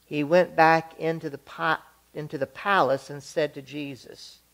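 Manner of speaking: usual speaking voice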